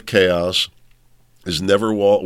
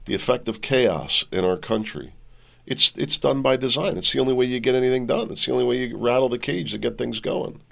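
ordinary talk, nothing marked

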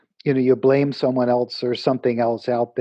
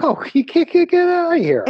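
high laughing groan, much higher; laughing strained; 14 semitones higher than usual. Retold or imitated. Successful people asked if it was easy, laughing high. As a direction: laugh it out like someone who barely survived. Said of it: "They're like".

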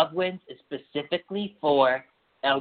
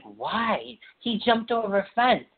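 higher mock defensive friends; 8 semitones higher, slower than usual. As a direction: play the friends defending him. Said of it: "my friends are like".

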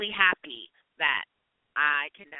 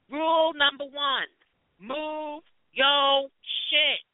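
high, slow, every word hammered; emphatic rule; 9 semitones higher, 3 dB louder, much slower than usual. Real, not acted. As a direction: lay down the law, word by word. Scolding train seat hogs.

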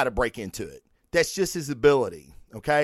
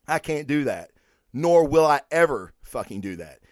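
ordinary talk

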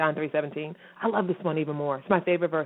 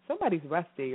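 ordinary delivery

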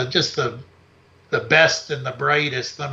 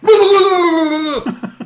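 high shrieking cackle at the junkie; mock shriek; 13 semitones higher, 6 dB louder than usual. Host startles the junkie from his window with a high shriek. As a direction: let out a sudden high wild shriek. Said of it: "and I went".

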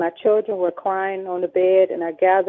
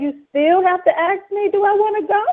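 higher, louder exasperated question; exasperated demand; 12 semitones higher, 6 dB louder than usual. Retold or imitated. Pressing the officers who offer protection, exasperated and loud. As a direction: push back at the police, fed up. Angry retort to police.

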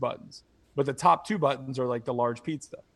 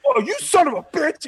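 mock outraged yell; 12 semitones higher, 7 dB louder than usual. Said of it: "he'd be like".

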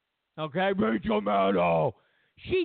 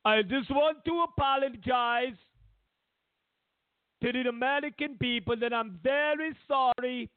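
mock contrite apology; 5 semitones higher than usual. Putin reading a public apology letter, hammed-up higher remorse. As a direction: read it like a fake tearful confession. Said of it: "Putin next week is gonna read a letter".

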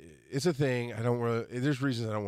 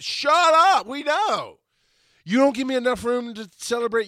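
loud, high-pitched cut-in; exasperated yell; 11 semitones higher, 8 dB louder than usual. Acted. Host fed up with birthday braggart, yelling. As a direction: yell over them to silence them.

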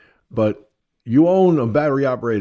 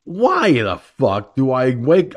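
usual speaking voice

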